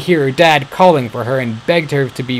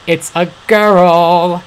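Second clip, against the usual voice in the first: excited discovery; 7 semitones higher, 5 dB louder, much slower than usual. Acted. Boy spots girl in castle.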